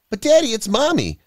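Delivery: put-on high-pitched, insistent little girl; breathless child. A narrator's reading. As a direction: plead excitedly as a small child. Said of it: "Mia panted breathlessly".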